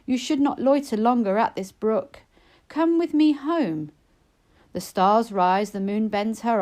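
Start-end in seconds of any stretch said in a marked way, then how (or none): 0.0-3.9 put-on higher, pleading young sister's voice; Lizzie urging. A narrator's reading. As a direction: urge her sister home, anxious and higher. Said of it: "Till Lizzie urged".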